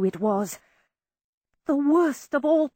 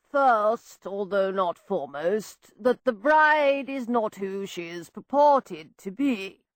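slow, lofty lecturing tone; pontificating; 2 semitones lower, slower than usual. A narrator's reading. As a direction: pontificate like a pompous brother. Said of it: "the same pontificating tone".

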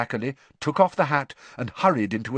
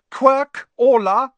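loud, high, stretched roll call; calling out names; 10 dB louder, slower than usual. A narrator's reading. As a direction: summon the pupil slowly and loudly. Teacher calls out name.

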